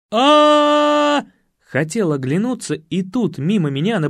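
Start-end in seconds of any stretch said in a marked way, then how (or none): 0.1-1.2 long, high, loud scream; крик сзади. Acted. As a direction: scream "aaa" from behind, held out. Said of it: "Услышал сзади крик". (протяжный высокий крик)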